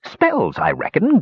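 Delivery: high-pitched grumpy dwarf; growled reply. A narrator's reading. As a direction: grumble it out, put upon. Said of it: "growled the dwarf".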